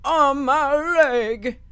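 high, loud, drawn-out wail; mock pained cry. Old man hurt in the face, drawn-out mock wail. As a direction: stretch it into a cartoon cry.

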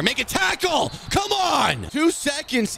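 high-pitched shouting at the team; desperate, exasperated holler; frustrated yell. Real, not acted. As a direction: yell at your defense to step up. Yelling at his defense.

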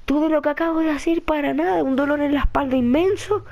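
high, pained complaint; whining lament. Real, not acted. Player who lost all his progress, whining lament.

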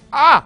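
short, high, loud "ah"; startled yell. Real, not acted. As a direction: cry out as if suddenly hit. Dies in the game.

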